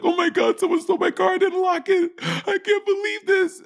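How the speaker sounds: high squealing panic; high frantic falsetto over a stolen car; panicked high voice. Retold or imitated. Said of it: "I was like".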